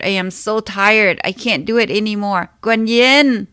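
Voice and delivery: loud, high, anguished crying out; desperate yell. Acted. Yelling to Guanyin at the end of her rope, anguished shout. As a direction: scream that you give up, voice straining. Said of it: "I yelled deeply inside from the depth of my core".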